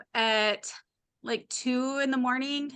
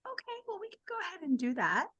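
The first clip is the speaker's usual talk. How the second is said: soothing midwife voice; 8 semitones higher, 9 dB quieter, much faster than usual. Retold or imitated. Midwives humoring her.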